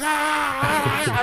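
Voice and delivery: high, startled yelps of panic; panicked cries. Retold or imitated. Retelling the jump, he yelps in high panic. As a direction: yelp like you're falling and it's gone wrong.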